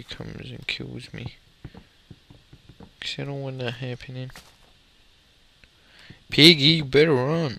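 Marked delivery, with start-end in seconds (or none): 6.3-7.6 mock threat. Threatening a pig in game.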